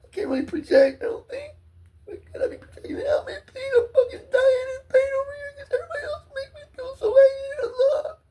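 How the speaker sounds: high, loud wailing through tears; sobbing shout. Real, not acted. Begging someone to come hug him, sobbing and shouting. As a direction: howl it out, desperate and broken.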